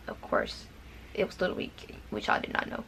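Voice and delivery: whispered, almost to herself; a confiding whisper to the camera; whispered aside. Real, not acted. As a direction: let it slip out in a whisper. Hushed aside to viewers.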